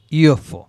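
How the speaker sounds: ইউএফও as yoo-eff-oh, Y sound first; ইউএফও pronounced with initial yo. Shown as a demonstration. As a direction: say ইউএফও starting with a Y glide, yo-fo. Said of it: "ইউটার যে উচ্চারণটা হচ্ছে ... ইয়ো".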